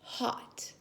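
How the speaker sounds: soft whisper; whispered word for children. Shown as a demonstration. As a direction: breathe the word. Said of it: "sign language for hot".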